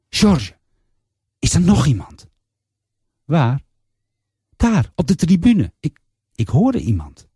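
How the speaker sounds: suddenly scared, calling out into the dark; patient, alarmed, asking the nurse if someone is there; startled alarm. Acted. Patient startled, hears someone watching.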